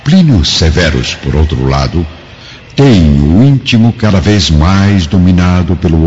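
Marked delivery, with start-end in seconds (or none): none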